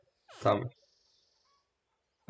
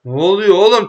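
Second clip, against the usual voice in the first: loud, higher alarmed question; startled shout; 6 semitones higher, 9 dB louder than usual. Real, not acted. Gamer startled by something sudden, loud. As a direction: blurt it out in shock.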